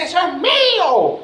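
slow, high proud claim; mock triumphant claim. Believer at church, claiming God's promise, slow proud cry. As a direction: proclaim it like a prize. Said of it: "grita y dice".

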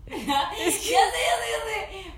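high, slow, through laughter; laughing. Real, not acted. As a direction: squeal the words out laughing. Laughing while answering.

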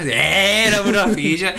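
alargado y más agudo, imitando a los lolos; jerga de lolos. Acted. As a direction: estira las vocales como un lolo exagerado. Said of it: "como dicen los lolos". (imitando a los lolos, arrastrado y agudo)

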